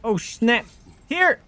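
high startled yelp. Real, not acted. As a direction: jump in surprise and shout. Sudden enemy attack.

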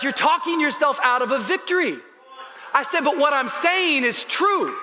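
high, loud shouted sermon; full-force preaching. Acted. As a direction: build to a shouted rallying cry for the congregation.

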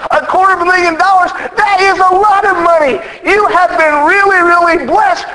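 high, incredulous friend; can't believe the sum he hears; amazed disbelief. Retold or imitated.

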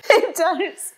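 a laugh through tears; tearful little laugh. Real, not acted. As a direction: laugh it out, relieved.

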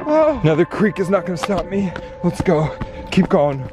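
ordinary talk

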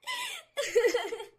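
high mad giggle; crazed laugh. Acted. Girlfriend laughing madly after a death threat. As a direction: laugh like a madwoman.

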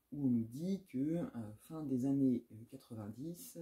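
usual speaking voice

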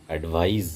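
অ্যাডভাইস ending in a buzzing voiced z; English advise. Shown as a demonstration. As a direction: say অ্যাডভাইস as ad-VIZE with a z sound. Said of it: "voiced sound ... জেট সাউন্ডের মতো হবে".

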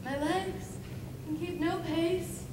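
a world-weary lament sinking to a near-hush; weary verse, fading. Acted. Hermia lost in the woods, fading from tiredness.

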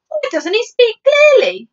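high, loud, exasperated question; irritated military complaint; snapping. Acted. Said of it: "the head of the army snapped".

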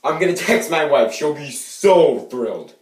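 loud, high-pitched excitement; over-the-top thrilled. Acted. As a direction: gush it at full volume. Acted thrilled reaction in a take.

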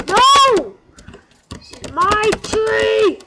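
high, loud, slow wail of outrage; drawn-out furious yell. Real, not acted. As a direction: stretch every word in rage. Tree taken.

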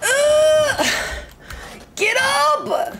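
high strained heave; effort grunt. Real, not acted. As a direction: strain hard, voice squeezed high. Heaving object, strained cry.

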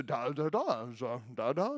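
put-on soft bouncy baby sounds; baby babble. Shown as a demonstration. Father showing how babies talk, soft babble. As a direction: lightly mimic baby talk. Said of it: "they talk like that".

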